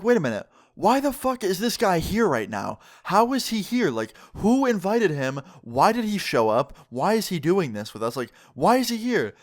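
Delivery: high-pitched, rapid-fire string of baffled questions; bewildered disbelief. Retold or imitated. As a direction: question it all, pitch swooping up. Baffled inner questions about the teacher.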